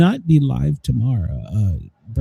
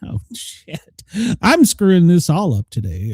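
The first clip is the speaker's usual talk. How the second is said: ordinary talk, nothing marked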